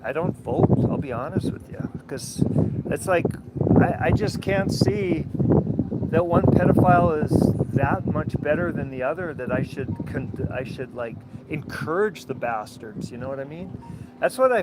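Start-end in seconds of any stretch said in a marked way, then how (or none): none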